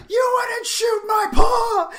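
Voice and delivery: high, slow mock-kid shout; hammed-up childlike desperation at full volume; desperate announcement. Co-host imitating a boy pleading for his pa, desperate shout. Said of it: "he announces, like, the desperation".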